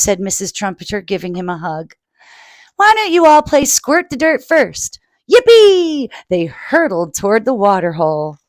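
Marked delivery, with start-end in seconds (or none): 2.8-5.0 mother voice. Acted. Mother to children. 5.3-6.1 loud high yippee; cheering. Acted. Children cheer.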